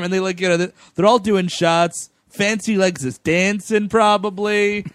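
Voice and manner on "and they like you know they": normal talking voice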